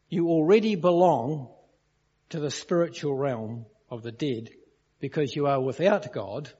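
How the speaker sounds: ordinary delivery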